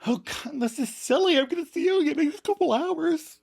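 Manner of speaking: very high-pitched mock crying voice; hammed-up weepy farewell; tearful goodbye. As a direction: squeak it out through pretend tears. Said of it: "so you can start crying".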